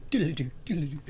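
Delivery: quick doodle-doo dream-transition trill; Wayne's World flashback noise. Retold or imitated. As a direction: mimic a flashback sound effect with your voice. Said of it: "Wayne's World thing".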